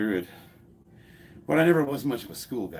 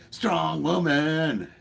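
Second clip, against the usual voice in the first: South Park quote; 7 semitones higher, 4 dB louder, much slower than usual. Retold or imitated. Quoting South Park episode.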